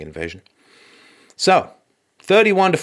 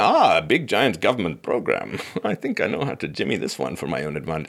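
muddled mock-Russian accent, sinking lower; bad Russian accent; 6 semitones lower than usual. Russian newcomer eyeing a government program, in a botched Russian accent. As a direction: attempt a Russian accent and miss. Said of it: "I don't even know what ac-, accent that is".

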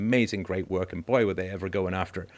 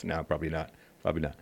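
quiet retreat; 6 semitones lower, 7 dB quieter, faster than usual. Real, not acted. Taking back the joke.

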